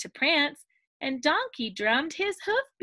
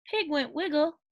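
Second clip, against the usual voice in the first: ordinary delivery, nothing marked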